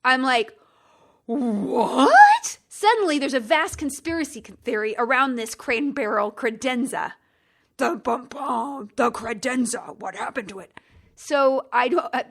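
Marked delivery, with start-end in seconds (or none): 1.3-2.6 shocked what. Retold or imitated. Customer told there is no delivery, shocked. Reacting to missing delivery news. 7.8-10.7 slow, hammed-up suspense announcer; mock mystery teaser. Podcaster spoofs a credenza conspiracy theory, mock-ominous.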